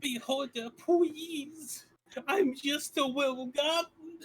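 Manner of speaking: high cutesy uwu pleading; Uwu speak. Acted. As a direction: beg in a squeaky cute voice. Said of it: "unironic Uwu speak".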